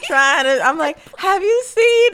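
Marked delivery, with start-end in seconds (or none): 1.2-2.1 anxious plea. Retold or imitated. Retold plea for lost friends.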